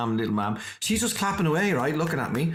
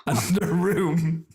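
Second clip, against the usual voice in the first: laughing; 3 semitones higher, much slower than usual. Real, not acted. Laughing at the memory.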